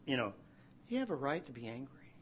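quick, hushed and kind; a gentle answer to a sulking servant; soft, gentle God. Retold or imitated. Preacher voicing God answering angry Jonah, softly and kindly. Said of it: "in this soft way".

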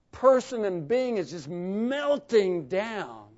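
loud, climbing sermon delivery; full-force preaching. Acted. Preacher to congregation, loud and driving.

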